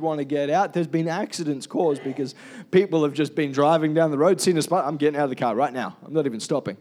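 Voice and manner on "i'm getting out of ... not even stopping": rushed, fast mock panic. Preacher acting a panicked driver who spots a spider, fast mock panic.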